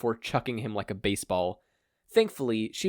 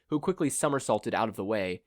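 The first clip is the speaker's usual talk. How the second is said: usual speaking voice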